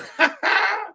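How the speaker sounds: sudden high-pitched burst of laughter. Real, not acted. Host cracking up at a chat joke, high laugh.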